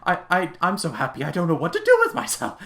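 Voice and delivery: reformed Scrooge overjoyed, imitated with a stammering laugh; giddy Scrooge. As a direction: bubble over like a man who can't contain his happiness. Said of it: "Scrooge just like".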